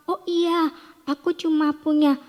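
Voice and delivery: high, acted realization; sudden remembering. Widow remembering she still has oil, acted bright realization. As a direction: pipe up as if it just came to you.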